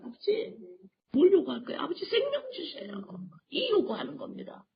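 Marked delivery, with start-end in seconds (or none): 0.0-2.9 high, crying plea to the Father; tearful prayer. Acted. Preacher acting a believer begging the Father for life, tearful. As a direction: beg for life through tears.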